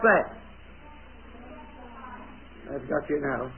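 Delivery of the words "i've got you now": much lower, softer playful aside; hushed tease. Real, not acted. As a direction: tease them quietly. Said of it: "teasing silent children".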